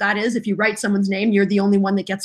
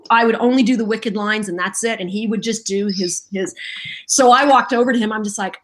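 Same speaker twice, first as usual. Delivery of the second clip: ordinary talk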